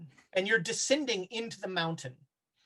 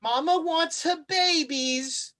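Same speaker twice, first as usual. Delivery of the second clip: high, slow, coaxing monster; drawn out like a hungry mother calling; eerie Mama voice; 8 semitones higher, slower than usual. Acted. The monster calling its babies, high and slow.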